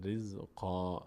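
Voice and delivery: رزقا ending in long aa; low, quiet riz-qaa for رزقا; pausal ending. Shown as a demonstration. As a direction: stop on رزقا without the n. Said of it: "که در هنگام وقف".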